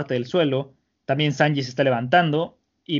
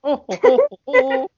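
very high, loud hooting; squealing, high mock hoots; hooting laugh; 12 semitones higher, 5 dB louder, slower than usual. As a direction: hoot it like a gleeful villain. Imitating Satori's hooting laugh.

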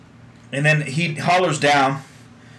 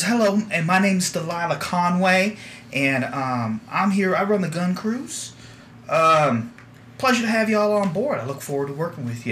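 a touch higher, friendly gunner; lightly voiced young woman; 7 semitones higher than usual. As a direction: greet the crew, a little bashful. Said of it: "she kinda giggles a little bit".

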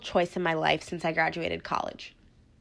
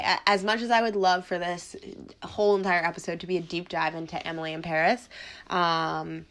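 ordinary talk, nothing marked

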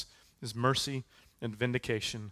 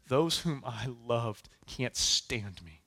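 slow paraphrase; 2 semitones lower, slower than usual. Acted. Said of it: "where he says".

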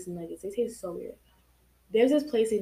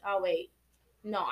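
ordinary delivery

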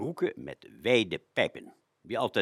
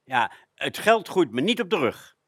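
higher, louder mock exasperated parent; a hint of a fed-up father; 6 semitones higher, 7 dB louder than usual. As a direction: sigh at a child begging for money. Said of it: "gezegdes van onze ouders".

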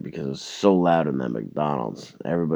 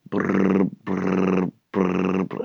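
trilled ringing noise on a raised, steady note; imitated phone ring; 4 semitones higher, much slower than usual. Caller waiting on friend to pick up, imitated phone ring.

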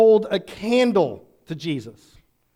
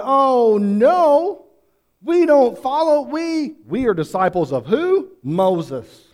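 higher, louder indignant protest; indignant outburst; 6 semitones higher, 5 dB louder, slower than usual. Retold or imitated. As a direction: bristle at the very idea. Leaders outraged, retold indignant reply.

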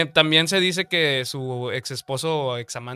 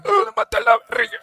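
high, crying mock confession; hammed-up dying wail; 4 dB louder than usual.

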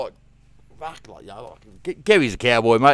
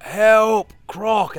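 high, loud cry for help; retold yell for help; 10 semitones higher, 10 dB louder than usual. Calling for help while a croc holds him, high loud yell. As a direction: yell it at the top of your lungs. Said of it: "I'm started just yelling out".

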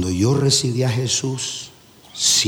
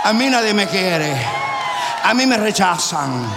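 mock self-pity; 8 semitones higher than usual. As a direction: complain like a poor victim, exaggerated. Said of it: "demonio de incredulidad tocándote el violín". (quejándose con autolástima fingida)

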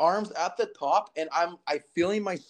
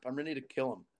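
ordinary delivery

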